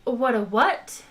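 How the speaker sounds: usual speaking voice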